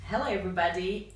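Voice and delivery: ordinary delivery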